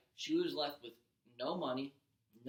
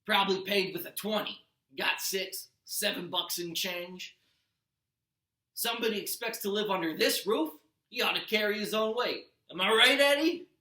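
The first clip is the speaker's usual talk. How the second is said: loud, higher, bullying slob voice; gruff Gabe voice; 5 semitones higher, 8 dB louder than usual. Acted. Stepdad Gabe taunts Percy at the poker table, loud and bullying. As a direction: needle the kid in front of his buddies. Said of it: "he said".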